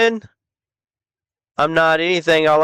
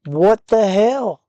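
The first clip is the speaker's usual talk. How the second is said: normal talking voice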